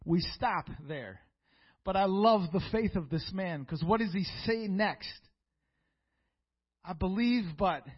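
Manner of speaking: loud, high, rising sermon climax; preaching at full force. Acted. Preacher lifting the congregation toward the father's cry, loud and high. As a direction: preach it out, building and ringing.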